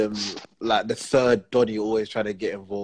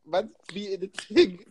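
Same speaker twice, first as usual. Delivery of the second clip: laughing; 11 semitones higher, slower than usual. Real, not acted. Laughing at a shocking story.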